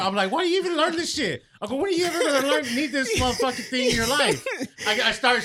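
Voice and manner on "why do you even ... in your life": mock rant. Ranting at son's homework.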